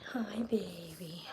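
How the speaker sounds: slow tender whisper; whispered coo. Real, not acted. Whispering hello to her purring cat. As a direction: breathe it softly to the kitten.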